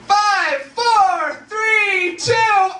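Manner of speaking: very high, loud, wailing countdown; hammed-up shrieking countdown, like the world is ending. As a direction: scream each number in mock doom. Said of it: "it would sound something like this".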